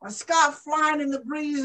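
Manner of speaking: usual speaking voice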